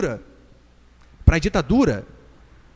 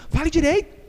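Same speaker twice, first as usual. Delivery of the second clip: firm correction; 7 semitones higher than usual. Acted. Models a parent correcting child.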